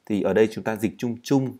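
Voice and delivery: ordinary delivery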